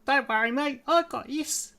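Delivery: put-on high Kiwi-accented elf voice. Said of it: "it's gotta be a Kiwi accent, but I've also got an elf voice".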